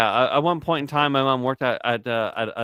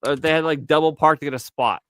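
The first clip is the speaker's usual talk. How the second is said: mock accusing rant; 6 semitones higher, 3 dB louder than usual. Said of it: "I'm like".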